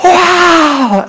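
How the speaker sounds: high, loud, awestruck wow; amazed kids' wow. Retold or imitated. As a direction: let out a child's gasp of wonder.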